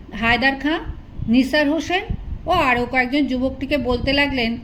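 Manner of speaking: ordinary delivery, nothing marked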